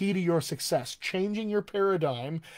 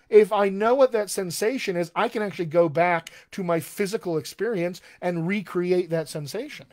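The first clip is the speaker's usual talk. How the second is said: ordinary talk, nothing marked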